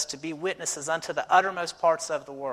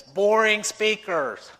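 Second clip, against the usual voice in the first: put-on dead serious kid; 6 semitones higher, 8 dB louder, much slower than usual. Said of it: "dead serious".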